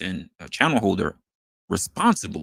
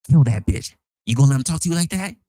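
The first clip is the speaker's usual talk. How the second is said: loud, fast taunting; egging on a fight; 3 semitones higher, 6 dB louder, faster than usual. Retold or imitated. The same commenters turn nasty, loud jeering goad. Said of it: "And they turn around".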